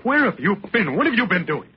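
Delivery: high, urgent demand; angry interrogation. Acted. Husband interrogates wife at dawn.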